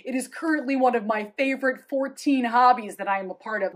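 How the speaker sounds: ordinary delivery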